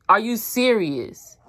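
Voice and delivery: normal talking voice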